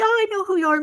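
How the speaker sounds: high-pitched imitation of instant recognition; imitated eager recognition. Acted greeting from the store owner.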